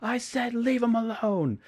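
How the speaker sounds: high, loud, slow imitated shout; angry yell. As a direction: bellow it out as a furious order. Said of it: "Sean's just like".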